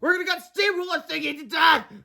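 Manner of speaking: very high-pitched wail; hammed-up terror; panicked scream. A narrator's reading. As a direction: cry out as doom descends. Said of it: "screamed Fluffy".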